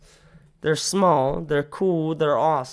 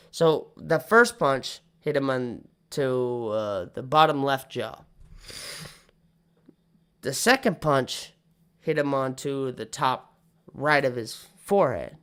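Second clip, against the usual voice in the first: normal talking voice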